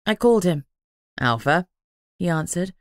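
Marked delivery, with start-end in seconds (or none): 1.2-1.7 courteous. A narrator's reading. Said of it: "his tone courteous".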